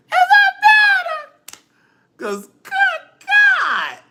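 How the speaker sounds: very high, slow, loud wailing sob; overdone crying plea, loud and high; mock crying. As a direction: ham up a tearful wail. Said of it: "having these tears be passionate".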